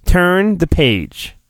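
plain reading voice

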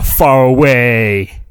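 slow storybook close on "far away"; lingering storyteller ending; drawn out. Acted. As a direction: stretch "far away" slowly. Fairy-tale opening drawn out.

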